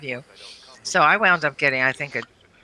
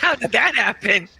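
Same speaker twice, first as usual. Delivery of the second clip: surprised; 7 semitones higher than usual. Retold or imitated. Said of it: "I was like".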